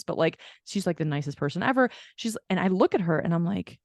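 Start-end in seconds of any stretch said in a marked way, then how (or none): none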